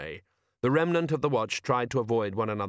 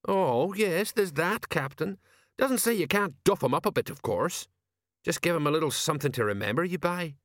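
lightly voiced old sergeant, higher, rambling; a hint of a gruff old copper; Sergeant Colon; 7 semitones higher than usual. Old sergeant explaining war to Angua, lightly voiced.

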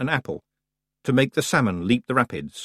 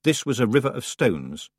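ordinary delivery, nothing marked

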